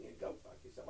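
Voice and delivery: hushed, breathy jab under the breath; whispered mock insult. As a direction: slip the insult in as a hushed joke. Mock insult aside to hosts.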